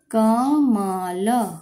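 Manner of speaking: कमल stretched very slowly; sounding out slowly. Shown as a demonstration. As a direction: draw out कमल syllable by syllable.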